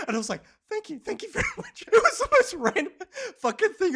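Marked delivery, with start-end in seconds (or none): 0.7-1.8 high mock thanks that break into a laugh; laughing over the thank you. Mock gratitude to the man. 1.8-4.0 loud, high laughter through the words; real laughter, loud and high; laughing. Real, not acted. Laughing at the memory.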